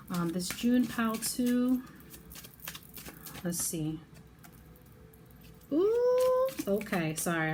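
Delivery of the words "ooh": high, drawn-out squeal of "ooh"; thrilled high-pitched "ooh"; excited ooh. Real, not acted. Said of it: "I'm getting excited for you".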